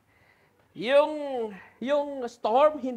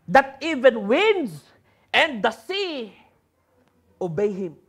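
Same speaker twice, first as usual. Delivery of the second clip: scripture reading; 3 dB louder, slower than usual. Acted. Reads the Bible passage aloud.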